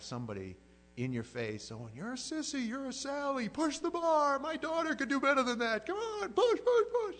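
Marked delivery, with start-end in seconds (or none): 1.9-7.2 high, loud mock trainer shouting; drill sergeant yelling. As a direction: bark it in his face like a gym coach.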